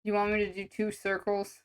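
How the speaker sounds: normal talking voice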